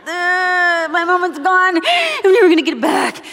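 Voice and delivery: high, loud wail over a lost moment; mock despair. Mom mourning her son running off to class, hammed-up wail. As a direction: ham up the heartbroken mom, half shouting. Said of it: "but I also was like".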